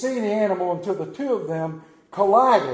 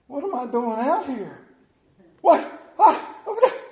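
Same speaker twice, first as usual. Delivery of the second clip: panicked Peter; 7 semitones higher, 6 dB louder than usual. Acted.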